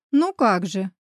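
plain reading voice

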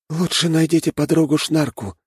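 high, deadpan little rat voice. A narrator's reading. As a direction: tease with no emotion at all. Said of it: "без всякой эмоции в голосе".

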